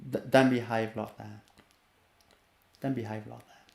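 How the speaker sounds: calm and firm. Shown as a demonstration. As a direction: stop the child quietly without heat. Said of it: "Calm and firm".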